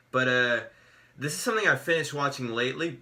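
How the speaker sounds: ordinary delivery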